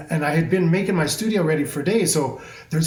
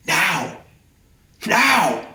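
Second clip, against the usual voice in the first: shouted demand; 2 semitones higher than usual. Retold or imitated. Demanding the vision from the universe, a sharp shouted now. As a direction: yell it like grabbing it by the throat.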